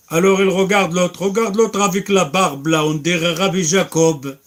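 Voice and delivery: a scornful onlooker pointing and laughing; jeering mocker. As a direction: jeer at the bearded man loudly.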